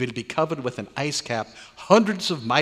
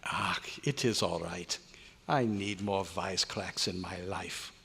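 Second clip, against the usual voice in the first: softened reassurance; 5 semitones lower, 8 dB quieter than usual. Acted. Scientist softens after apology.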